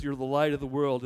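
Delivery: usual speaking voice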